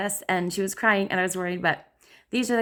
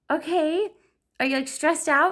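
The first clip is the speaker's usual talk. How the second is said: higher gentle question; soothing mom; 8 semitones higher than usual. Retold or imitated. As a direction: mom comforting daughter. Mom soothing crying daughter.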